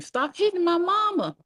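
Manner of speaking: high-pitched screamed protest; child's scream. A narrator's reading. Crying child grabbing grandmother's leg, screaming. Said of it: "I screamed".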